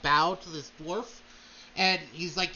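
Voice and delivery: usual speaking voice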